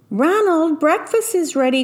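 high call from another room. Acted. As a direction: call out to him. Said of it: "called Mama from the kitchen".

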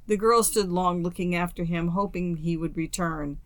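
usual speaking voice

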